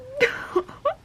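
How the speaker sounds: tearful high-pitched sob; high crying sob. Real, not acted. Reader crying over a book, high sob. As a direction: sob wordlessly, high and strained. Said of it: "I just cried for that".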